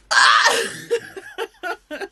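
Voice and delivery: high-pitched mock scream breaking into a laugh; frustrated yell. Reliving the frustrated call with mom, mock scream. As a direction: let out a high, exasperated yell, acted.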